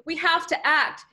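rallying yell, moderately loud. A narrator's reading. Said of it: "yelled Sam".

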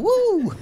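high whoop; car-window woo; woo cheer. Shown as a demonstration. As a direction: let out a joyful woo. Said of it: "so I'll just go".

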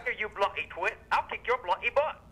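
threatening mock Australian accent; Australian-sounding English accent. Prank caller threatening the host, fake Australian accent. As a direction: talk tough in a fake English-Australian twang. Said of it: "talking with an Australian accent".

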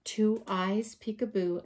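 plain reading voice

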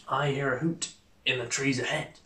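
a lightly voiced Gruffalo; monster voice. Gruffalo speaks in story.

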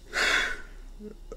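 long unvoiced tired sigh; weary sigh. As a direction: breathe out all the annoyance. Weary boss before answering.

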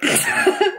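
real amused laugh. Real, not acted. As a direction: burst of high laughter. Grandma's boob joke.

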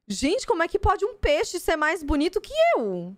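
playful jealous shriek; excited disbelief. Real, not acted. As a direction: squeal at the pretty fish. Gushing over a shiny fish card.

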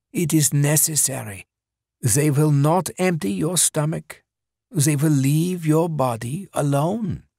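measured, menacing gentleman; calm villain voice. A narrator's reading. Villain voice again.

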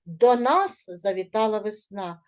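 нас stressed, falling end. Shown as a demonstration. Teacher models the correct reading for pupils, нас stressed. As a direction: hit нас clearly. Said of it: "я вам розкажу, як треба було прочитати".

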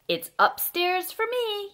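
high call with little extra volume; mild storybook yell. A narrator's reading. Ted claims the upstairs room, high playful mock yell. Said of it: "yelled Ted".

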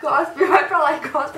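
slow, mouth crammed full; cheeks stuffed full. Real, not acted. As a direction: struggle through a full mouth. Mouth full, telling to spit.

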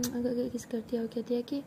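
usual speaking voice

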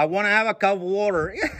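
put-on American-accented "water". Someone asking for a drink, American accent. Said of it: "تنطقها زي الأمريكان".

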